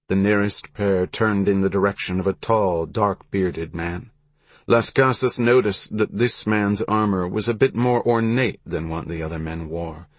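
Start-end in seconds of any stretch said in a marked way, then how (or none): none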